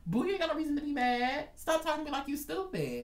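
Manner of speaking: high-pitched, drawn-up scolding without extra volume; sassy retort. Acted. Worker answering people who talk down, high sassy retort. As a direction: put them in their place up high.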